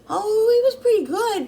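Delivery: high, loud imitation of her son; son's polite answer. Mom imitating her son saying the food was good, high and loud. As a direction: play the son, voice lifted high and bright.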